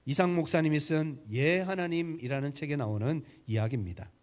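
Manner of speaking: normal talking voice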